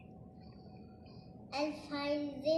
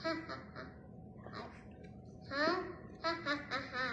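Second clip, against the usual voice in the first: soft, staged ha-ha laugh; demonstrated laugh; 6 dB quieter, much faster than usual. Shown as a demonstration. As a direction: act out laughing in short separate bursts. Demonstrating a laugh among sounds.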